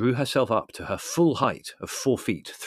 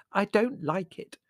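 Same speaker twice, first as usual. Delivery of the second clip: high, slow, nervous murmur; shaky whisper; 11 semitones higher, slower than usual. A narrator's reading. Prue uneasy at the séance, whispering shakily. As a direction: say it anxiously, pitched high and drawn out. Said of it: "whispered Prue shakily".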